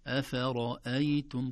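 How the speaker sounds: "أفرأيتم" slow, every letter thin except a full ر; light letters, heavy ra. Shown as a demonstration. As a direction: keep the vowels of "أفرأيتم" light, only ر heavy. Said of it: "كلها مرققة". («أفرأيتم» بترقيق الحروف وتفخيم الراء وحدها)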